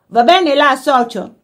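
high, loud hearty call; shouted call. A narrator's reading. Workman calling to his mate on the job, shouting. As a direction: call out to a workmate across the lawn. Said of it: "qualcuno gridò".

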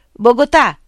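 a blind villager calls across the meadow; calling out. A narrator's reading. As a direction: call the name out loud and high. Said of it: "chiamò uno". (chiamata ad alta voce)

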